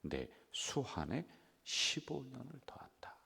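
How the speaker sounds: 수완에 drawn slowly in a whisper; awed whispered restatement; hushed emphasis. Shown as a demonstration. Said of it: "기도를 들었고 눈물을 보았다".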